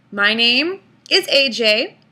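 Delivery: normal talking voice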